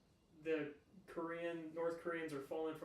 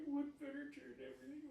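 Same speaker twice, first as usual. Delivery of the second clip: much higher mock-imitation of a friend; teasing imitation; 11 semitones higher, 4 dB quieter than usual. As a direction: mimic their wistful wish in a high voice. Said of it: "You're like".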